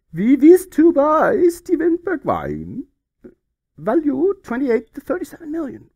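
high, loud, slow mock German accent. Reading the Wolfsburg fax aloud in a hammed-up German accent. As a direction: bellow it like a stiff German official.

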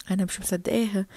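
low adult aside; doubting parent. Acted. Parent confides doubt about the child, low. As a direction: drop the voice well down, sceptical.

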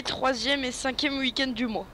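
ordinary talk, nothing marked

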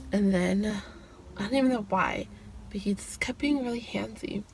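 hushed, unvoiced confession; whisper. Real, not acted. Confiding to viewers about his wandering hands, in a whisper. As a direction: whisper it like a secret.

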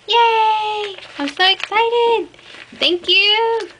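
high, loud thrilled squeal; excited squeals. Real, not acted. Opening a friend's gift, squealing with delight.

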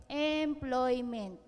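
Employment drawn out long, each syllable slow; stretched out slowly. Shown as a demonstration. Speaker stretching Employment for the class while spelling, slowly drawn out. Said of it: "habaan natin".

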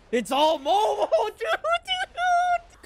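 high-pitched, laughing hype; excited laughing squeal. Real, not acted. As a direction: squeal it through real laughter. Excited reaction to the episode.

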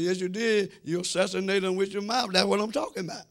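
normal talking voice

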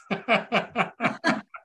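a bright burst of real laughter; high, open laugh. Real, not acted. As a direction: laugh out loud at the joke. Laughs at audience comment.

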